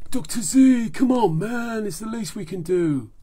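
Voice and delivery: loud, high, beefy hero voice; big friendly praise from a tough guy; hearty action hero. Acted. Action Man cheering up Doctor Z, hearty and loud.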